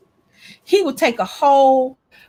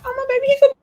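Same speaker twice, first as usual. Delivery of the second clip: cooing delight; 11 semitones higher, much faster than usual. Retold or imitated. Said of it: "I was like".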